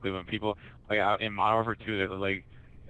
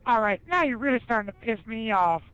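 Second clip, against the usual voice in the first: very high, louder, mock-angry cartoon voice; 6 dB louder than usual. Retelling the episode, the cartoon character losing patience, very high and louder. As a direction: climb higher and louder into a fake tantrum.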